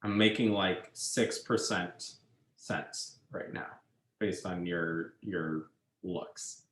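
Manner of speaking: drops to a soft, half-voiced mutter; trailing off under her breath, self-mocking; quiet aside. Real, not acted. Teacher muttering to a confused class, quiet aside.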